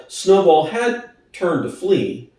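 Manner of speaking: plain reading voice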